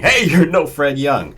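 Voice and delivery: hammed-up shout of disbelief; screaming. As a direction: yell it out in disbelief.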